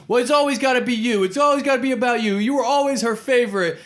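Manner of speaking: a high, whining squabble voice; high whiny bickering. Retold or imitated. Wesley Snipes bickering with Woody Harrelson in the street, high whining voice. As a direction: whine it like a jealous sibling. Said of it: "He goes".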